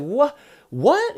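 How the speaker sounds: high, loud acted "what?"; shocked disbelief. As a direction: play the disciple's stunned protest. Disciple's shocked refusal, acted.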